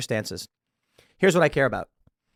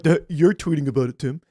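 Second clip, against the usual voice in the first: mock dumb critic. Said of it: "people being like".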